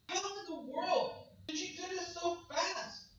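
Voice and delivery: high, loud cry of disbelief; exasperated. Acted. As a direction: throw up your hands at the Galatians. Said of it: "He's like".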